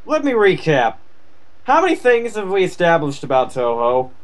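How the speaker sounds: ordinary talk, nothing marked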